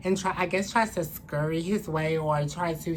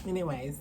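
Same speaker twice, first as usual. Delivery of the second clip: sigh; 3 dB quieter than usual. Real, not acted. Sighing before starting the story.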